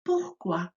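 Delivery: "Pourquoi" said poor-KWAH with a French r; French word pourquoi. Shown as a demonstration. Teacher models "Pourquoi" for pupils to repeat. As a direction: model "Pourquoi" for the class to echo. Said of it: "Repeat after me".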